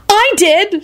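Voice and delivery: high-pitched indignant retort; angry spluttering. A narrator's reading. Woman contradicts the servant, spluttering angrily. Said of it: "Shala spluttered out angrily".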